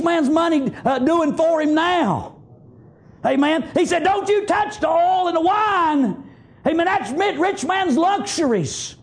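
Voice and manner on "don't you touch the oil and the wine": slow, high preaching shout; booming scripture quote. Acted. Preacher shouting the Lord's warning to the rich, slow and high. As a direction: thunder the warning from the pulpit.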